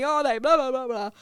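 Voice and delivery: ordinary talk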